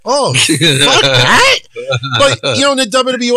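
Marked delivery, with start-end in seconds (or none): 0.0-1.6 slow, scoffing "Oh, fuck that" with "that" dragged and rising; drawn-out dismissive. Shown as a demonstration. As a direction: wave it off slowly, stretching "that". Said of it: "you can emphasize different words".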